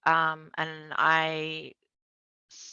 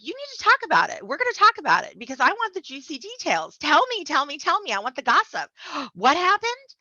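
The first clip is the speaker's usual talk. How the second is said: much higher and louder, gushing for juicy details; excited gossip; 10 semitones higher, 7 dB louder than usual. Retold or imitated. Best friend begging for the juicy details, much higher and louder. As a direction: beg for gossip like a thrilled friend. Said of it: "And she's like".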